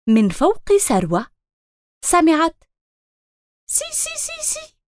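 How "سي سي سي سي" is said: very high, soft chirping tweet; bird chirp. Acted. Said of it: "زقزقة".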